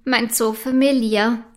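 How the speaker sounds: usual speaking voice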